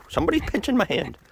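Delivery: high, strained complaint; high pained complaint. Real, not acted. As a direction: complain while being pecked. Being pecked.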